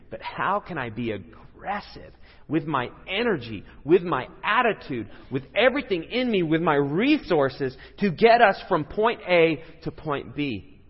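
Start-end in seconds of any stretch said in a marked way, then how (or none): 2.5-10.8 emphatic preaching. Real, not acted. Preacher building emphatic list.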